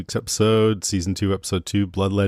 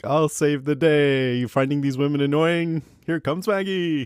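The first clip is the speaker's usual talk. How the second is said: mock heroic Maggie; 6 semitones higher than usual. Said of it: "It's like".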